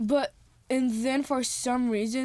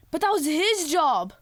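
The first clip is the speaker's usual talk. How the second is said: higher, louder retold exclamation; exclaimed protest; 5 semitones higher, 5 dB louder than usual. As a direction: blurt it out in protest. Acted protest in retold film.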